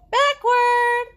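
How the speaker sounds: high, loud cry of jump; shouted command. Acted. Piggy yells the dance move at Gerald. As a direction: fling the word out.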